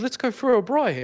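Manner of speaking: high, swooping mock South African accent; hammed-up, horribly bad South African accent; Russell Crowe. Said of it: "really horrible South African slash Russian accent".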